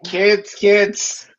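hammed-up weary peacekeeper; mock exasperated parent. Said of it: "I just sit here like".

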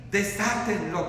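ordinary talk, nothing marked

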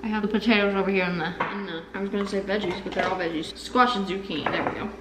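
ordinary talk

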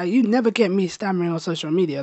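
normal talking voice

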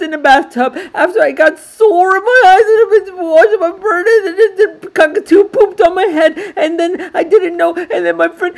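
put-on very high, loud, fast tearful child voice; upset little boy wailing his woes; crying boy rant. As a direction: pour it all out like a sobbing little kid.

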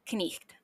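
"knecht" with the K sounded before the N; slow demonstration of "knecht", K and N both heard; Old English pronunciation. Shown as a demonstration. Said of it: "and pronounced".

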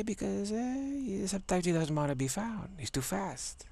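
high-pitched character boast, dropping at the end; playful high pitch. Acted. Friend explaining why he can't be found, playfully high. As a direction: sing his praises, pitched well up.

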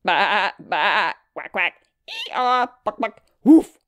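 bleat, quack, bray, cluck and loud bark; farm animal noises. A narrator's reading. Farm animals all talk at once, in imitated calls. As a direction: imitate each animal in turn. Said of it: "started talking at once".

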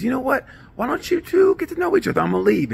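put-on high, sing-song girl voice; hammed-up girl voice passing him off to Jen; girly voice. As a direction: play the scheming date, way up high. Date voiced setting up her friend.

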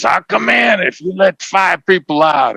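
hammed-up Hollywood hostage line; movie negotiator. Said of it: "where Eddie Murphy says".

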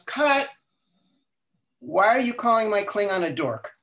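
a high, loud mock yell of cut, then an exasperated question; director yelling cut. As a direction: retell the director stopping the take with a yell. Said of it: "Nimoy yells".

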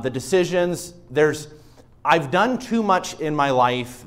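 fervent preacher pleading with the congregation; preaching at full force. Acted. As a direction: preach it out with rising fervour. Preaching builds loud.

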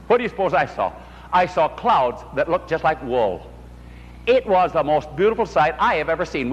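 normal talking voice

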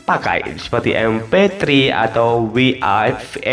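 ordinary talk

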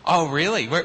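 ordinary talk, nothing marked

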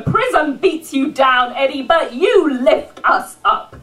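rousing slam-poetry delivery, swelling and falling; rhythmic live poetry recital; spoken-word poem. Acted. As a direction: perform it as a defiant tribute poem. Performs a spoken-word tribute poem.

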